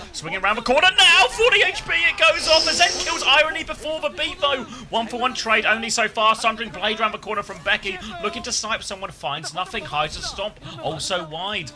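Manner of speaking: high, loud live game call; excited play-by-play. Acted. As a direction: call the fight as it happens.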